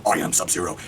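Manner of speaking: a hint of a cold menacing ninja; Sub-Zero line. Sub-Zero introducing himself, lightly voiced low. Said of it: "eventually Sub-Zero".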